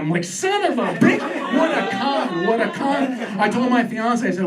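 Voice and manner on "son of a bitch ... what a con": high-pitched comic outrage; exasperated, pitched-up fury played for laughs; indignant outburst. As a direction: curse like you've just been swindled. Outburst at being cheated by medium.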